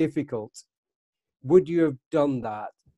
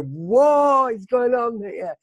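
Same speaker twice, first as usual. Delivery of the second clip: slow, high, wondering; drawn-out puzzled wonder; amazed bystander; 7 semitones higher, 4 dB louder, much slower than usual. Retold or imitated. Onlookers amazed by limousine.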